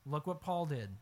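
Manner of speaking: usual speaking voice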